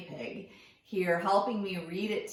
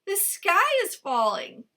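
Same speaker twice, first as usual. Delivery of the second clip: high, louder mock panic; alarmed cry; 9 semitones higher, 7 dB louder than usual. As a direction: sell the panic to trick the fox. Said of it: "cried Cuy".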